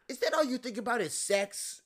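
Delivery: hammed-up high offended date; mock girl voice. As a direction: squeak it out as the scandalized girl. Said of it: "he's like".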